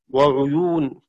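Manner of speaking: وعيون with a u after the ayn; careful "ʕuyūn" in وعيون; damma on ayn. Shown as a demonstration. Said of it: "وقرأ حفص بضمها".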